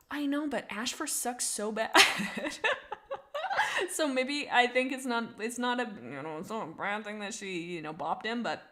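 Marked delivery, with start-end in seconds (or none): 2.0-3.9 bursts into a high laugh. Real, not acted. Laughing at Ashfur remark.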